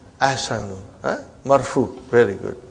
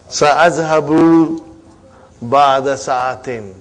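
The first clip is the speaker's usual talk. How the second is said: slow model answer; 2 semitones lower, slower than usual. Shown as a demonstration. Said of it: "teacher models answer slowly".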